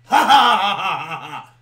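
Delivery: high, loud hammed-up cackle; loud fake laugh. Mock laugh in the bit.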